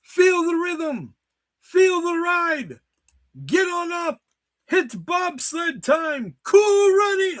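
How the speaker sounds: very high, slow, loud Jamaican chant; Jamaican accent. Pretend. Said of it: "I can't even do that one".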